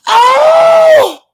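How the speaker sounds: high, loud cry of joy; joyful shout. Real, not acted. Streamer shrieking with joy at a viewer's good news. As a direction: burst out shouting her name in delight.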